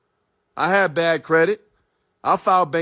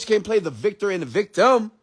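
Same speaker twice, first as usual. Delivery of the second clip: ordinary talk, nothing marked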